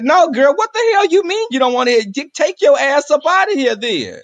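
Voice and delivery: loud, high, indignant clapback; sassy outraged retort. Retold or imitated. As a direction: tell her off, all sass. Heated retort to girlfriend.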